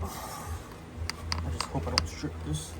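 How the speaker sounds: breathy whispered hope under the breath; whispered worry. Real, not acted. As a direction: murmur it tensely, barely voiced. Tense moment loosening stuck bolt.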